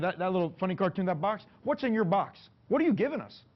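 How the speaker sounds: high, rising challenge; challenging the kid. Retold or imitated. Coach confronting an entitled recruit, high rising challenge. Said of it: "that's what I told him".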